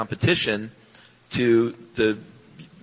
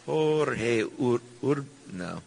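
half-hearted mock Italian accent on the name; 5 dB quieter, much slower than usual. Cohost requests it, tries name in light mock Italian accent. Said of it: "say that in your Italian accent".

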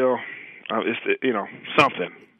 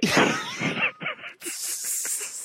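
airy squeal of a laugh; high wheezing laugh at the news; a breathless, airy laugh; 7 dB quieter than usual. Real, not acted. Reaction to news.

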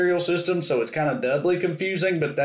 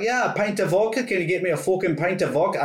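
higher-pitched smart-ass Scottish imitation; mock Scottish accent; 4 semitones higher than usual. Drunk tourist teasing a Glasgow clerk, mock Scottish accent. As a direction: throw the clerk's Scottish accent back at them. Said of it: "I started doing her accent back to her".

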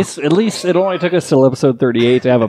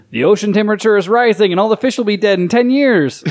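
mock jump scare shout; 8 semitones higher than usual. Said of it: "I jump out and I go".

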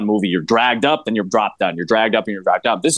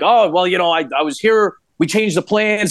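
higher mock Frank making an excuse; imitating Frank lying; 7 semitones higher than usual. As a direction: pitch up into a nervous imitation of Frank. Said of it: "He's like".

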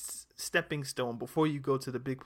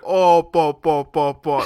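loud excited commentator calls; imitating an Arabic football commentator, loud; 12 dB louder, slower than usual. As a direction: belt out the commentator's "po po". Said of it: "He's like".

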